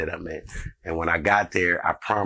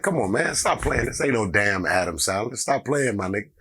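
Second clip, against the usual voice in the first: disbelieving brush-off; 3 semitones higher than usual. Retold or imitated. Jokester refusing to believe the caller, incredulous brush-off. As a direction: wave off an obvious prank caller. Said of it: "I said it just like that".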